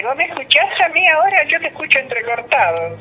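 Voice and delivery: speaking up on a choppy call; raising voice over bad line. Real, not acted. Caller straining over bad line.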